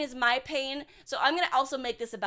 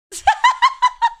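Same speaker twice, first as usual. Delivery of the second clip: sudden loud, high cackle; real shrieking laugh; burst of laughter; 13 dB louder than usual. Real, not acted. Streamer cracking up at her own sociopath joke, loud laugh.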